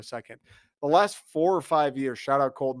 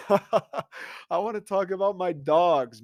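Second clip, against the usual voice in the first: laughing it out, slowed and higher; 5 semitones higher, slower than usual. Real, not acted. Podcast host laughing to listeners about his team. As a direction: chuckle through the words.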